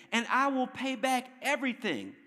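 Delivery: high-pitched plea in a preacher's reading; begging. A narrator's reading. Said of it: "he begged".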